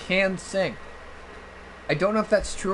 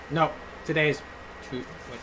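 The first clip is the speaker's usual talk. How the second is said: usual speaking voice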